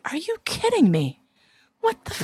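reacting in stunned disbelief at the father's praise; retold disbelief. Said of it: "I'm sitting there going".

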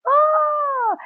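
a high hammed-up crying wail; mock wail. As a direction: fake a burst of bawling. So I immediately started bawling.

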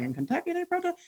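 a hushed, disbelieving question that leaps up at the end; mock-shocked, as if it can't be true; incredulous surprise. Telling a colleague's story, hushed incredulous surprise. Retelling his disbelief at Kentucky protests.

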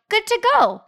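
high sing-out all-clear; cheery go-ahead voice. Acted. Bag flashing green to a sober person, high go-ahead voice.

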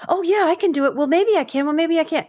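high, wavering, flustered yes and no; knee-jerk flustered answers. Retold or imitated. People pleaser imitated, high and flustered. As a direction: dither nervously between yes and no. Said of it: "these knee-jerk".

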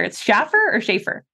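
"Schaffer" with a short a, then "Schaefer" with a long ay; comparing two pronunciations. Shown as a demonstration. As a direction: offer "Schaffer" against "Schaefer" as a question. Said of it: "with pronunciations here".

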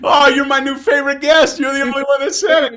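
high, loud, laughing delight; host overjoyed at being named guest; delighted shout. Real, not acted. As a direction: burst out thrilled at the answer.

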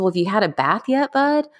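gentle mom voice. Retold or imitated. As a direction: ask him like a caring mom. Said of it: "And I said".

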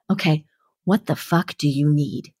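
a hint of a gruff music producer; blunt gruff question. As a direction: drop your voice and ask it bluntly. Imitating [name]'s blunt question.

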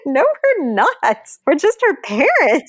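brushing off the compliment through laughter; a laughing, disbelieving no; laughing protest. Retold or imitated. Parents answering "you are so courageous.

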